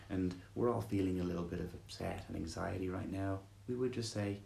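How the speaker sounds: soothing guided meditation read. Acted. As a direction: ease them gently into stillness.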